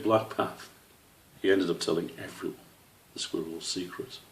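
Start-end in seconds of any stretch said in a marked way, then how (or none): none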